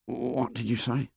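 soft, hushed question; hushed boy's query; whispered reply. A narrator's reading. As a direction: ask it under your breath. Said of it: "Piggy whispered back".

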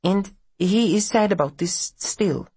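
put-on high, careful foreign-accented mother; mother's voice. A narrator's reading.

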